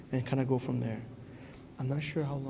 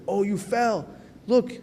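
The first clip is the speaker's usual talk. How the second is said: higher, louder taunting of the fallen; mocking devil; 7 semitones higher, 5 dB louder, slower than usual. Devil mocking the one who fell, jeering. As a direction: point and jeer like a disloyal devil. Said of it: "He's like".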